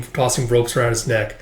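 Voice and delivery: usual speaking voice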